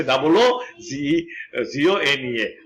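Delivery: deliberate spelling for students; slow spelling out. Shown as a demonstration. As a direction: spell double ও জি ই slowly, then উগোনিয়া. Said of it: "এটা হচ্ছে উগোনিয়া".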